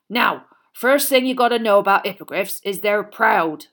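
big booming hearty giant; a jolly loud gamekeeper; Hagrid voice. A narrator's reading.

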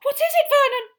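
very high, loud frightened cry; terrified shriek. Acted. Aunt to husband about the fireplace, terrified shriek.

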